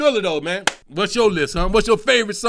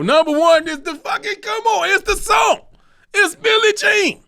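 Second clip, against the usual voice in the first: loud, much higher hype shout; big reveal; 14 semitones higher, 5 dB louder than usual. Real, not acted. Revealing his number one pick to viewers, hyped shout. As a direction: announce it like a champion.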